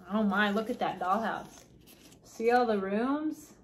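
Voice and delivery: plain reading voice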